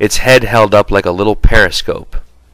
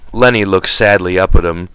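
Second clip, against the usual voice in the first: ordinary delivery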